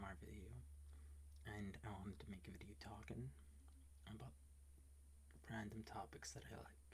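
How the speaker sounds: hushed whisper for ASMR. Real, not acted. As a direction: whisper so the roommate sleeps. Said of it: "I'm whispering because".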